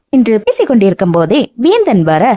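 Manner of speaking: ordinary delivery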